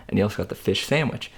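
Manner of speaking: ordinary talk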